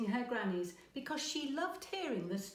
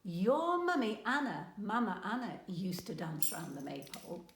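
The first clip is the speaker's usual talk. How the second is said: usual speaking voice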